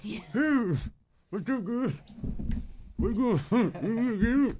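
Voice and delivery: high-pitched talk through clenched teeth; wired-jaw voice. Shown as a demonstration. Showing friends how talking with a wired jaw sounded, high through shut teeth. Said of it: "I could only talk like this through my teeth".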